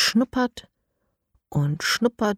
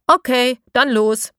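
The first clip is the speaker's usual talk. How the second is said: higher, louder rallying call; 6 semitones higher, 5 dB louder than usual. Acted. Grandma rallies the children to start the search, loud and brisk. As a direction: send the team off with energy. Eda sends off the team.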